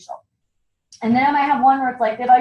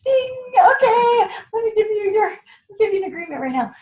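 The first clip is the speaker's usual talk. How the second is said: mock excited pounce; 11 semitones higher than usual. Consultant mimicking herself spotting a recruit, hammed-up shout. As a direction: seize the sales opening with a hammed-up yell. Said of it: "And I'm like".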